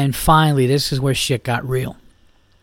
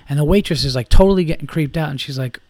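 ordinary talk

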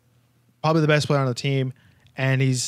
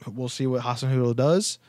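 ordinary delivery

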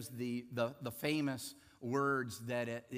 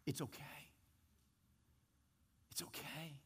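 gentle hushed reassurance; 12 dB quieter than usual. Retold or imitated. Coffey calming guards before death.

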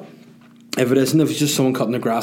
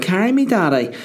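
high-pitched imitation of a little girl; wee daughter; 9 semitones higher than usual. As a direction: plead like a small child to dad, pitched way up. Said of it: "put her down, said".

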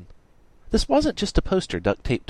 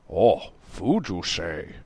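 character voice, suddenly interested; perked-up interest; 3 semitones lower, much slower than usual. A narrator's reading. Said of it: "Bowman perked up".

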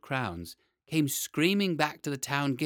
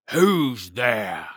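growling dragon guarding treasure; 9 dB louder than usual. Acted. Dragon answers knock on door.

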